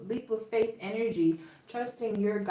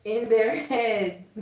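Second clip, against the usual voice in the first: laughing; 2 semitones higher, 7 dB louder, slower than usual. Real, not acted. Laughs mid-sentence at the cards.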